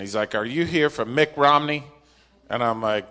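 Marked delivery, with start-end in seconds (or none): 0.3-1.8 retold clerk with a thick Boston accent, a bit higher and louder. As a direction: imitate a Boston clerk with a dropped R. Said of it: "he had a really strong Boston accent".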